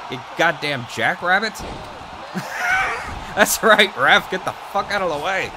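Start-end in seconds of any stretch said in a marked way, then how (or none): 1.6-3.3 long high-pitched laugh; giddy high laugh; high cackle. Real, not acted. Laughing at reversal. 3.4-5.5 loud, higher "That's right, ref"; triumphant yell; shouting at ref. Real, not acted. Yelling at the referee.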